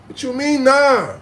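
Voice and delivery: high, rising-loud indignant challenge; incredulous comeback. Retold or imitated. Challenging their refusal to come uptown, incredulous outburst. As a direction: act out outraged disbelief, pitch way up.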